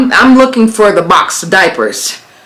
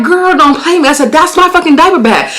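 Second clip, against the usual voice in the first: angry confrontation; 8 semitones higher than usual. Retold or imitated. Confronting the thief.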